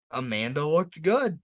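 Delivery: usual speaking voice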